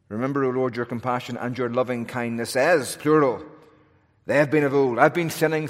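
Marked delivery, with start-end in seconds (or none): none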